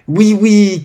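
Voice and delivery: high, loud, eager yes; children crying out. A narrator's reading. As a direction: shout it high like excited kids. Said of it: "crièrent-ils ensemble". (cris d'enfants enthousiastes, aigus)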